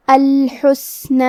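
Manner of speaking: الحسنی drawn out slowly with a whispery س; tajweed demo. Shown as a demonstration. Teacher showing students how to say الحسنی, slow and deliberate. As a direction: model الحسنی slowly, letting the س breathe. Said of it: "تو ہم یہ کلمہ کو ایسے پڑھیں گے".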